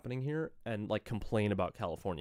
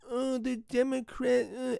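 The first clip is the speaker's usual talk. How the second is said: much higher, slow whiny mock wail; mock crying complaint; 12 semitones higher, slower than usual. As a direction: parody them whimpering. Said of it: "Crying about".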